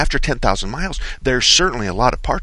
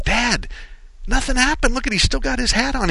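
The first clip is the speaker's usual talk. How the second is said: higher, lightly voiced young son; son's voice; 13 semitones higher than usual. Father voicing his son at the scene, higher. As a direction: pitch up for the kid. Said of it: "My son was going".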